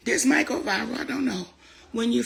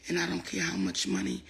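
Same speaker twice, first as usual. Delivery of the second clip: ordinary delivery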